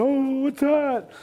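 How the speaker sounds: very high, drawn-out mock wail; frightened sister. Sister hears the roar, high drawn-out frightened imitation. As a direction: imitate her panicked cry, slow and way up high. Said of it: "My sister's".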